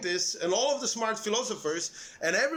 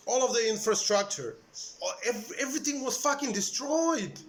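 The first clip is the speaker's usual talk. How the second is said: usual speaking voice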